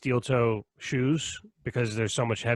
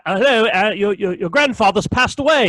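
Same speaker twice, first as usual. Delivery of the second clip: higher, louder hammed-up British accent breaking bad news; mock British accent; 6 semitones higher, 5 dB louder than usual. Imagining delivering sad news in a British accent, a hammed-up British accent. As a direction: play a stiff British messenger, overdone. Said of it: "laugh every time someone cries".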